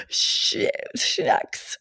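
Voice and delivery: unvoiced startled whisper; whispered dismay. Seeing her own goal, whispering shit shucks. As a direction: hiss it under your breath, caught out. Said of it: "I was like".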